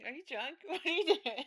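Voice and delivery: laughing through a teasing question. Retold or imitated. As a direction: giggle while asking. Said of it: "Jacob was like".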